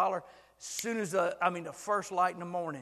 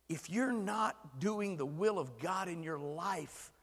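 ordinary delivery

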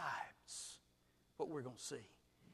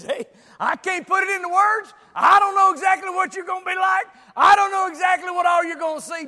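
preaching at full force; 22 dB louder than usual. Acted. Preacher pressing congregation on how long, shouting. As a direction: drive it home to the back row. John voiced while preaching.

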